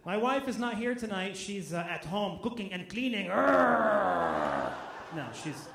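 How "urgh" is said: frustrated groan. Acted. Fake reaction to wife joke.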